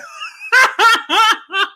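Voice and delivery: very high cackling laughter; shrieking high laugh; real laughing fit. Real, not acted. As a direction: crack up helplessly. Breaks up imagining viewer's reply.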